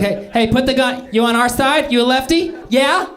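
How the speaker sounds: high-pitched, nervy challenge; far left guy. Acted. Comic playing a far left guy challenging his lookalike, high nervy voice. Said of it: "some far left guy".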